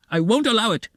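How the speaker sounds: high-pitched angry shout; heated, high outburst. A narrator's reading. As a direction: cry out your refusal. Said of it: "Raskolnikov shouted again".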